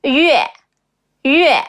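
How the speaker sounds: 月 as rounded "yu-eh", falling sharply from high. Shown as a demonstration. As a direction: start 月 high and let it drop. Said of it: "the falling tone".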